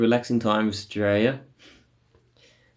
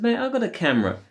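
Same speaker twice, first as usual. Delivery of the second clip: pitched-up mock retort to the cop; indignant retort; 12 semitones higher, faster than usual. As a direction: snap back, voice jumping higher.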